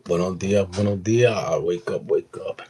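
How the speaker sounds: loud, drawn-out morning wake-up call; rousing greeting. Real, not acted. Host calling viewers to wake up, loud rousing greeting. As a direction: call out loudly to rouse everyone.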